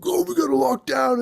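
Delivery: a hammed-up alarmed shout, pitched high; mock panic. A movie character discovering the lab virus, mock alarmed shout. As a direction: cry out like a movie extra in a panic.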